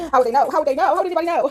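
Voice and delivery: high, loud imitation of panicked bafflement; mock disbelief. Mimicking the shocked person, laughing.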